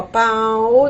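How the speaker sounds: "pau" stretched, A fading into a weak U; diminishing diphthong. Shown as a demonstration. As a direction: draw out "pau", letting the sound diminish on U. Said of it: "Diminui ditongo decrescente". ("pau" esticado, diminuindo no U)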